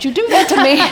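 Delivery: high, fast laughing plea; laughing protest. Retold or imitated. Mother protesting to Jesus, laughing and high. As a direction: laugh through a mock protest. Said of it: "I'm like".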